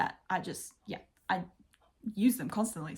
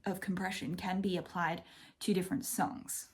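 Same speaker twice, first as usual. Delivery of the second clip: usual speaking voice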